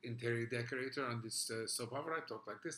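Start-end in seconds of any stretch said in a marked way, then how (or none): 2.2-2.8 put-on Russian accent. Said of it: "Let me hear your Russian".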